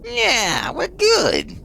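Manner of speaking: very high, loud, squeaky voice. Pretend. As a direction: squeak it out like a cartoon.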